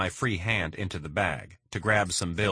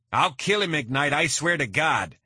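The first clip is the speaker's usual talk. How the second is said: desperate yelled threat; 7 semitones higher than usual. A narrator's reading. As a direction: swear it at the top of his voice.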